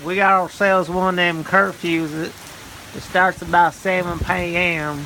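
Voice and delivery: ordinary talk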